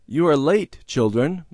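mildly stern mother; mother's reproach. A narrator's reading. Mother glancing at the clock at late children, reproachful.

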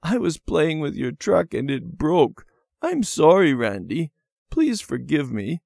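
put-on high, tearful little-girl voice; crying. A narrator's reading. Debbie confessing to Randy about the broken truck, crying. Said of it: "She was crying".

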